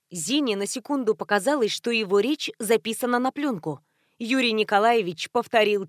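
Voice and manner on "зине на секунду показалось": plain reading voice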